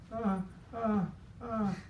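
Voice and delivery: high startled ah ah ah; imitated high startled yelps. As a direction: mimic a caught man's yelps. Plumber caught, startled cries.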